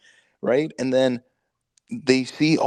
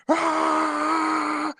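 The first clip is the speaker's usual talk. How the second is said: high hammed-up battle yell; mock battle cry. Retelling the gunner scene to friends, hammed-up battle cry. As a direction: belt a silly war cry. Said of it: "he's like".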